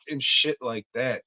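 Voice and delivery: normal talking voice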